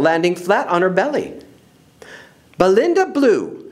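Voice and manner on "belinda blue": teacher's reproving call, pitched up; scolding. Acted. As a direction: scold the pupil by her full name. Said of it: "scolded Miss Briggs".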